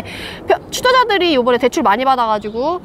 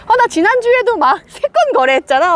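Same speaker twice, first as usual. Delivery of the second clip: higher, lively imitation of a realtor; upbeat realtor; 6 semitones higher, 3 dB louder than usual. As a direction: brag about brisk deals with a chuckle. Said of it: "톤이 확 바뀌었다는 게".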